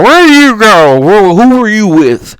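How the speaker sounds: slow mock-girlfriend interrogation; questioning girlfriend. Sally questioning her boyfriend at the beach, slow mock interrogation. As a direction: imitate a girlfriend demanding answers. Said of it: "Sally said".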